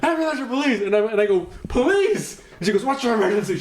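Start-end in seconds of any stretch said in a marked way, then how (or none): 0.0-0.8 high, mimicked emergency operator; stressed-out dispatcher. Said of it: "like, fucking stressed out". 1.7-2.4 mock urgent reply. Mock reply to stressed dispatcher. 2.8-3.6 higher-pitched, imitated emergency operator; stressed-out dispatcher. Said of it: "like, fucking stressed out".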